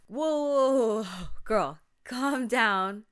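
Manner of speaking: loud, drawn-out interruption; calm-down interjection. Acted. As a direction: cut in loudly to slow a frantic friend. Acted friend interrupts panicked caller.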